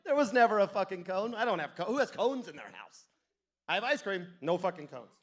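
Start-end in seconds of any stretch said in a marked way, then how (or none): none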